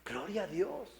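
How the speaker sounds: soft breathless whisper of relief; hushed, almost voiceless gratitude; whispered awe. Retold or imitated. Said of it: "Y yo dije". (susurro conmovido)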